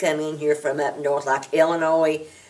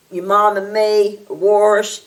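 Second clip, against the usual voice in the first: "wash" said "warsh" with an r; a kid imitating an Appalachian lady saying "wash"; a slightly louder Appalachian "warsh"; Appalachian accent; 4 semitones higher, 5 dB louder, slower than usual.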